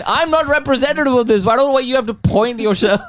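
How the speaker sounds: put-on high, protesting Indian accent; hammed-up, high-pitched Indian indignation. Put-on Indian voice, protesting being blamed.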